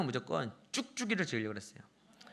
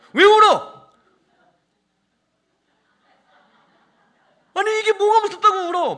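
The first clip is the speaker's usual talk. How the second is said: put-on dad yelling at his crying child, high and very loud; angry shout; 20 dB louder than usual. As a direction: explode at the child at full volume. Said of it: "화가 나는 거예요". (화가 나서 버럭 지르는 고함)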